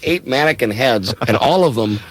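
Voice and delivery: normal talking voice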